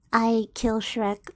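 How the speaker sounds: ordinary talk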